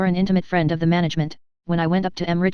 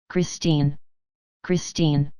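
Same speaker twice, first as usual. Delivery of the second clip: moaning call; 3 semitones lower, much slower than usual. Acted. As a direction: moan the name into the dark, a touch of despair. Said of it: "He moaned, calling to her".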